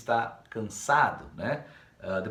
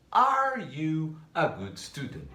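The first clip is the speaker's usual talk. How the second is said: slow, clear question for students to copy; slow model question; 5 semitones higher, 3 dB louder, slower than usual. Shown as a demonstration. Teacher modelling the question slowly for students. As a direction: spell it out slowly for the class. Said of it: "trazer o are pra frente da frase".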